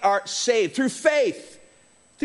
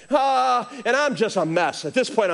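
usual speaking voice

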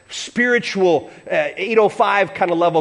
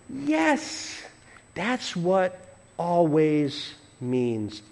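patient reassurance; 7 dB quieter, much slower than usual. Acted. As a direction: answer softly, drawing out each word. Gently answering the mock objection.

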